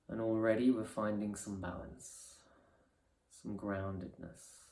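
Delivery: soft, unhurried meditation voice with long pauses; guided meditation. Acted. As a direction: ease them gently into resting awareness.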